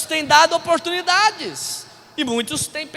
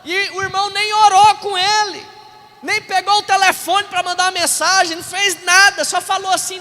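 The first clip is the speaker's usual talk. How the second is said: higher, insistent preaching voice; indignant preaching; 7 semitones higher, 5 dB louder than usual. Real, not acted. Pastor faulting a brother who didn't pray, indignant preaching. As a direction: hammer home the missed chance.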